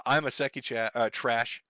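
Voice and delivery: ordinary delivery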